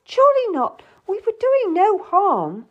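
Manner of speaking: shocked disbelief, voice jumping up; gasping in shock. Acted. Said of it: "I gasped".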